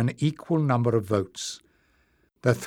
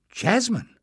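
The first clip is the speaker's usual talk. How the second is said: surprised exclamation; 10 semitones higher than usual. Acted. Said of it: "Leaf exclaimed".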